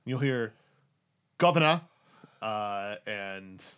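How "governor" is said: high, loud call from down the street; officer hailing from the intersection; distant call. Acted. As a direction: call out from far off. Voice calling from afar.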